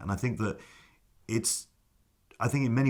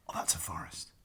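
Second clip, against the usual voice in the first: soft unvoiced whisper, lower; whispered thought; 3 semitones lower, 10 dB quieter than usual. Nervous actor whispering to himself onstage, soft whisper. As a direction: breathe it out as a secret relief.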